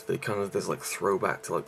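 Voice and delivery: ordinary talk, nothing marked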